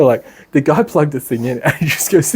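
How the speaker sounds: ordinary talk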